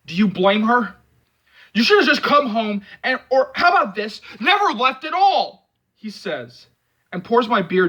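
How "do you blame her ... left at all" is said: loud, high scolding; angry. A narrator's reading. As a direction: rub it in hard. Angry confrontation.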